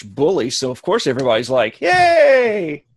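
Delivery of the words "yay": cheering. Acted. Crowd cheering.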